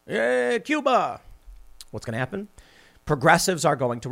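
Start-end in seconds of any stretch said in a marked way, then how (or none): 0.1-1.2 high, hammed-up Biden impression; mock Joe Biden. Host mocking Biden on Cuba, hammed-up high impression. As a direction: lampoon the president, pitched up high. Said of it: "if Joe Biden comes out and says".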